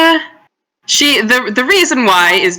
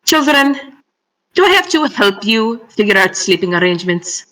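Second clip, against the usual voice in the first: mildly exasperated, condescending question; a touch of long-suffering patience; sighing exasperation; 4 semitones higher than usual. Acted. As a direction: address them wearily like squabbling children. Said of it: "She kinda sighs".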